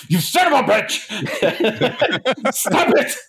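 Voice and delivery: high, laughing mock scold; laughing at himself, mock angry; laughing self-scold. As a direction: scold yourself through laughter. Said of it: "and I'm like".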